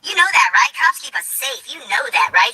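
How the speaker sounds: high-pitched pleading impression of a woman; mock indignant police supporter; imitating a scolding woman. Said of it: "she's telling me".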